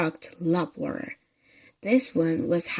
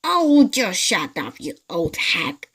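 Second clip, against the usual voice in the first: put-on gruff old man snapping at his wife; annoyed shout; 6 dB louder than usual. Mr Twit snapping at his wife, loud and irritable. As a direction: shut her up with a louder rough bark.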